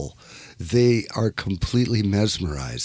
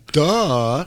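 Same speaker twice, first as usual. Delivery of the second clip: a sarcastic, higher and louder duh; mock duh; 7 semitones higher, 5 dB louder than usual. Host voicing the listeners, a mock sarcastic duh. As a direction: toss off an obvious duh, mocking the listeners. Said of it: "ninety percent of the listeners are saying".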